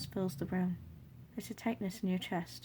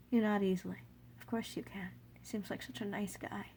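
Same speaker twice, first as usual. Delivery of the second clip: plain reading voice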